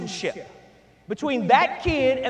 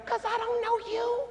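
sassy retort; 10 semitones higher, 6 dB quieter than usual. Retold or imitated. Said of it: "they say".

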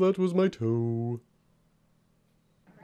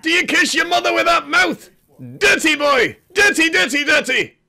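higher and louder, scolding indignation; mock outrage; 13 semitones higher, 7 dB louder than usual. The dog scolds the man spraying water, higher and louder in mock outrage. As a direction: tell the sprayer off in mock fury.

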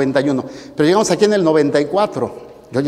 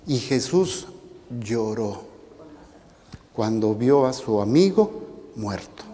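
low, hushed telling with pauses; quiet, solemn; 6 semitones lower, 7 dB quieter than usual. A narrator's reading. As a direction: let each phrase fall softly into silence. Solemn telling of Jesus weeping. (voz baja y solemne)

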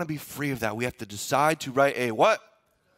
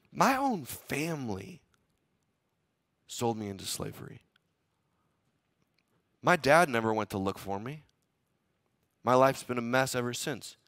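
mild self-pity; 5 semitones lower than usual. Retold or imitated. Joseph's bitter self-loathing voiced.

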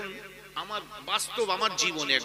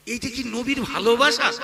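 loud, raised rhetorical question; indignant challenge; 5 semitones higher, 8 dB louder than usual. Real, not acted. Preacher challenging the congregation about the procession, loud indignation. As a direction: throw the question out louder and higher, building to the last word.

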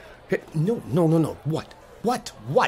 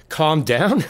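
louder, commanding order; forceful calm down; 4 semitones higher, 6 dB louder than usual. Acted. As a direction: shut him down. Confronting the man.

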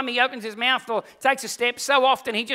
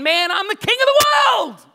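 loud, high cry of triumph; triumphant shout; 10 semitones higher, 8 dB louder than usual. Acted. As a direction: crow it like you own the world. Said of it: "Peter sitting there thinking".

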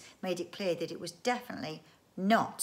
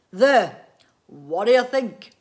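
very loud, raised, drawn-out flourish; proud unveiling; 3 semitones higher, 16 dB louder, slower than usual. Acted. Inventor shows family his creation, loud and proud.

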